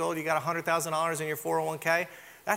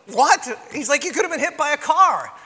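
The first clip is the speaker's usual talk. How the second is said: shocked neighbor; 8 semitones higher, 5 dB louder than usual. Retold or imitated.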